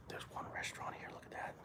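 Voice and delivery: hushed whisper in a quiet lobby; library whisper. Real, not acted. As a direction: whisper so you don't disturb anyone. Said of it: "I'm saying whispering because".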